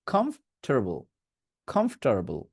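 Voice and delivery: American কমফোর্টেবল as "KHOMF-ter-bul"; American pronunciation. Shown as a demonstration. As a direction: put an r in the middle: কমফোর্টেবল as khomf-tur-bul. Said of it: "যদি আপনি আমেরিকানে যান". (American কমফ-ঠার-বল)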